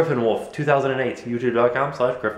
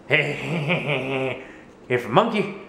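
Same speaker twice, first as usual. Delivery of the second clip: drawled, halting presidential parody; comic political impression; George W. Bush; 2 semitones higher, much slower than usual. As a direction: do a mock president, dragging the opening. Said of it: "that guy".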